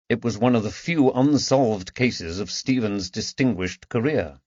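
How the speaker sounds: plain reading voice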